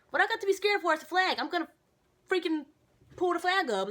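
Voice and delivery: high, loud indignant outburst. Retold or imitated. To the sergeant asking if she's scared, high loud indignation. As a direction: blow up at the question, pitch way up. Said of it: "I'm like".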